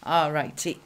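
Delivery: ordinary talk, nothing marked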